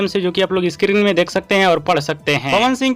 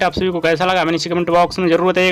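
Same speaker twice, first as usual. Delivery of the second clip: rapid news reporter; 3 semitones lower, faster than usual. Acted. Rapid channel outro.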